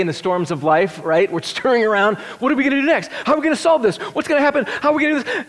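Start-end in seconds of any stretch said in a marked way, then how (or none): 2.4-5.5 high, frantic, worried questions; panicked questions. Retold or imitated. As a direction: voice the scurrying disciples in a panic. Panicked disciples voiced in storm.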